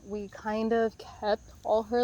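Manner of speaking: usual speaking voice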